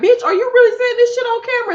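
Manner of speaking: squeaky high, fast disbelief; shocked disbelief. Retold or imitated. Man shocked his partner spills rumors on camera, high fast disbelief. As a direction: gasp it out in outraged shock. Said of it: "And he looking like".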